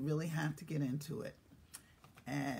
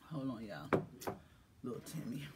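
whisper; 8 semitones lower, 9 dB quieter than usual. Real, not acted. Whispered call into phone.

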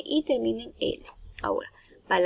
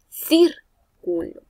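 "Círculo" with CÍR high, loud and drawn out; slow "Círculo", leaning hard on CÍR; stress on first syllable; 6 semitones higher, 6 dB louder, much slower than usual. Shown as a demonstration. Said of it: "porque es una esdrújula".

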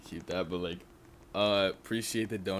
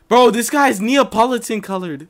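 high, loud exclamation of disbelief; amazed outburst; 13 semitones higher, 13 dB louder than usual. Real, not acted. Streamer spotting an oddly colored player, amazed outburst. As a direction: blurt it out at the top of your pitch.

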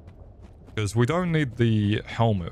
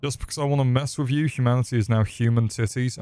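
normal talking voice

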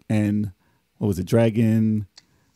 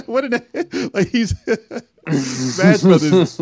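laughing; 7 semitones higher, 4 dB louder, much slower than usual. Real, not acted. Amused at the quote.

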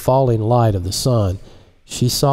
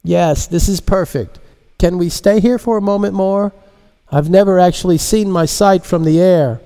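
woman voice; 9 semitones higher, 3 dB louder than usual. Acted. Marise answers pilot.

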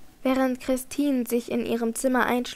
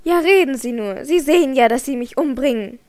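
louder, desperate cry; desperate outburst; 8 dB louder than usual. A narrator's reading. Christine cries out to Raoul that he is killing her. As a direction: hurl it at him in despair.

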